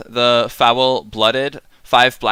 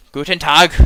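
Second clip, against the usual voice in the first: louder, higher mock-stern German greeting; serious German voice; 5 semitones higher, 5 dB louder than usual. Streamer greets a possibly German viewer, mock-serious German emphasis. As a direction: bark it like a grave German officer. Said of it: "like to put some emphasis in my voice".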